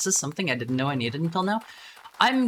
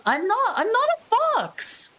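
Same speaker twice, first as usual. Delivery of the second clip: indignant high yelp; 3 dB louder than usual. Real, not acted. Denying being a fox.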